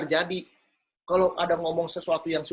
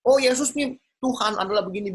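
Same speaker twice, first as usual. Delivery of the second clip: lightly mocked preacher, higher pitch; hint of a preachy believer; imitating eager preaching; 6 semitones higher, 4 dB louder than usual. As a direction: launch into a mock mini-sermon. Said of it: "mulai dia khotbah tuh".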